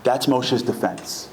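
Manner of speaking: ordinary talk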